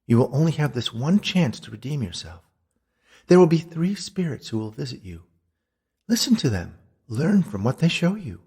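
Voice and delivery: high, emphatic ghostly warning; ghostly Beatrice. Acted. Ghost tells him of three spirits, high and solemn. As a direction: press the warning home, pitched high.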